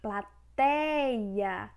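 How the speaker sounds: plaTEEIa, TEI drawn out; stress on TEI. Shown as a demonstration. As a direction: stretch the middle of Plateia. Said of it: "é paroxítona".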